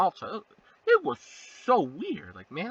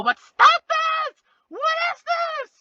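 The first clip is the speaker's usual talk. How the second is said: high, loud, slow outraged shout; mock shout; 6 dB louder, slower than usual. Telling the moaning robots to stop, high outraged shout. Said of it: "I'm thinking".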